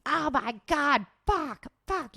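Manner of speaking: high-pitched, drawn-out panicked mock scream; retold scream. Customer yelling as the chip rack falls on her kid, mock scream. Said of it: "And she's screaming".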